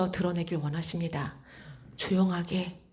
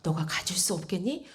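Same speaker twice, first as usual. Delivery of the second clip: soft, hushed question; gently imploring, softer voice; tender, earnest plea; 3 semitones lower, 7 dB quieter than usual. Retold or imitated. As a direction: ask quietly, as something precious. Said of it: "너무나 소중하게, 너무나 간절하게 말씀을 하시는".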